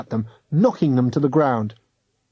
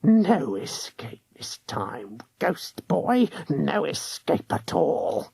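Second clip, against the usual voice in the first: slow whispered hag mutter, softer; muttered to herself; 3 semitones higher, 5 dB quieter, slower than usual. A narrator's reading. Ghost hunter waiting for the ghost boy, whispered mutter. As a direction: mutter it gloatingly under breath. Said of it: "muttered to herself".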